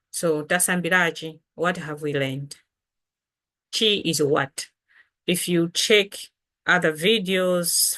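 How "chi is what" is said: ordinary talk, nothing marked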